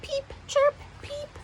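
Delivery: very high thin peeping; baby bird peeps. Retold or imitated. Narrator imitates hungry baby birds, peeping high. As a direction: chirp like a hungry chick. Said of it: "peeping and chirping noises".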